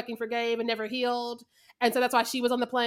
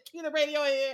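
normal talking voice

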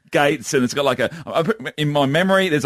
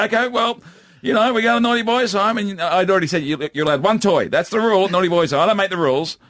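higher-pitched dad laying down the law; mock-stern dad; 6 semitones higher than usual. Dad bluffing his toddler, mock stern. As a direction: play the bluffing parent.

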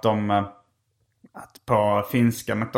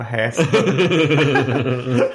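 laughing; 2 semitones higher, 3 dB louder, much slower than usual. Retold or imitated. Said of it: "vad heter Häsburger?".

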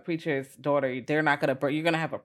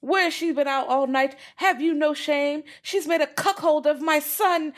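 scandalized in-law; 10 semitones higher than usual. Retold or imitated. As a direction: huff it out like an outraged puritan father. Scandalized father-in-law voiced in recap.

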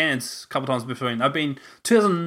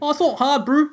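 put-on higher, louder Kiwi accent; pitched-up mock Kiwi; New Zealand accent; 13 semitones higher, 7 dB louder than usual. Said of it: "New Zealand accent".

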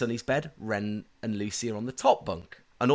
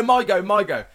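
imitated eager child, high and loud; Ren; 9 semitones higher, 8 dB louder than usual. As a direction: mimic a kid begging for a turn. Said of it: "And Ren goes".

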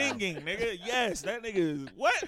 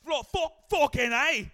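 raised, loud put-on British accent; Peaky Blinders British accent; 6 semitones higher, 7 dB louder, slower than usual. Shown as a demonstration. Friend retries his British accent after being rated, loud Peaky Blinders accent. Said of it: "you gonna Peaky Blinders now".

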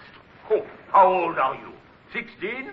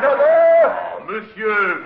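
loud, high protest; alarmed outcry; 4 semitones higher, 6 dB louder, much slower than usual. Acted. French officer crying out at the course for England, an alarmed outcry. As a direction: cry out in disbelief.

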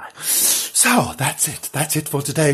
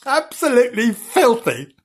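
higher, relishing the word filthy; mock disgusted; 7 semitones higher than usual. Frank about Bernard, gleeful mock disgust. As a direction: savour the insult with glee.

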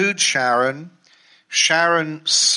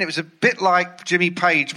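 ordinary talk, nothing marked

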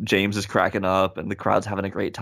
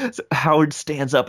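ordinary delivery

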